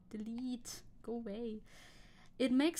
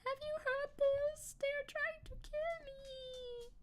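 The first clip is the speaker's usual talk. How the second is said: very high, slow, frightened dog voice; panicked dog voice; much slower than usual. Acted. Streamer imitates her jumpy dog hearing noises, very high and slow. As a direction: voice the paranoid pup in terror. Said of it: "Tenji would be like".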